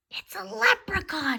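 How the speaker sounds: high breathless whisper of discovery; high hushed amazement; amazed whisper. A narrator's reading. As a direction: gasp it out in wonder. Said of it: "he cried".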